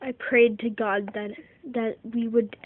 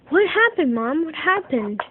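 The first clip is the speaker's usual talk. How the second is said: put-on worried kids; 5 semitones higher, 5 dB louder than usual. Kids rush to crying mom.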